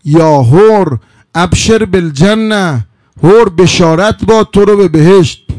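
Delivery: loud, slow, drawn-out proclamation; solemn celestial call, ringing loud; heavenly voice. Acted. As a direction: proclaim glad tidings like a voice from heaven. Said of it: "این صدای ملکوتی".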